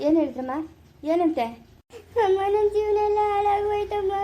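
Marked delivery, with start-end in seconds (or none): 2.3-4.1 higher, wailing through tears; crying wail. Acted. Geetha wailing to her mother-in-law before telling the news. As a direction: sob out the line to your mother-in-law. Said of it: "ಹೇಳ್ಬಿಟ್ಟನ ಅಳು".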